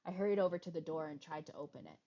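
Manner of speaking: ordinary talk, nothing marked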